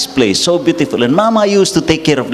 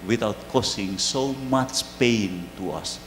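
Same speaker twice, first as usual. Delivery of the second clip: tender words to mother; 4 semitones lower, 13 dB quieter than usual. Real, not acted. Priest speaking to dead mother.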